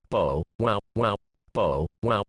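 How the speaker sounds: barked bow wow like a real watchdog; dog barking. A narrator's reading. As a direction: bark it out like a proud watchdog. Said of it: "And he barked, as if he were a real watchdog".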